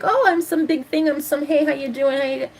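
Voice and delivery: high, mocking full-of-himself voice; mocking a self-important guy. Mocking a man who thinks he's a big thing, high and smug. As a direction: sneer it out like a smug flirt. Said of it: "they're like".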